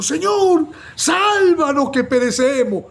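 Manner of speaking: high, loud, slow plea for rescue; terrified cry. Acted. Disciples shouting to the sleeping Lord, terrified. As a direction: cry out to be saved from the storm. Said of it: "Le gritan".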